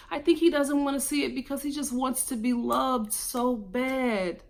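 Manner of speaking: usual speaking voice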